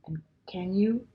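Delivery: ashamed whisper, rushed at the end; whispered plea. A narrator's reading. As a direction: barely breathe the question for forgiveness. Ashamed faun begs forgiveness.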